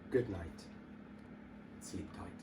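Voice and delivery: tuck them in gently; soft goodnight. A narrator's reading. Closing the bedtime story.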